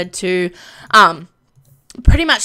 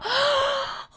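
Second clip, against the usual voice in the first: sharp gasp; 8 dB quieter than usual. Real, not acted. Shock at Sim's state.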